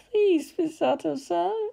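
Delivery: soft, slow, small pleading; a tiny plea from a hurt boy. A narrator's reading. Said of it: "His voice was so tiny".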